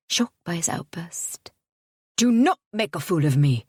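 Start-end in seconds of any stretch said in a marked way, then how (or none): 2.2-3.7 louder, through clenched teeth; narrated suitor seething through his teeth. A narrator's reading. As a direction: force it out through gritted teeth. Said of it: "he said through his teeth".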